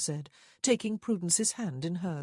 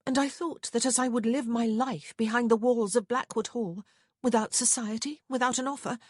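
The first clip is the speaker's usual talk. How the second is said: higher-voiced young sister confessing; Prudence, defiant; 7 semitones higher, 4 dB louder than usual. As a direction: explain yourself insistently, pitched up.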